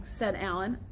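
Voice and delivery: usual speaking voice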